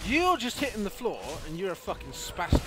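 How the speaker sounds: loud, high mocking shout; angry taunt. Real, not acted. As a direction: jeer at the enemy. Player mocking enemy loudly.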